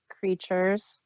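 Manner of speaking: usual speaking voice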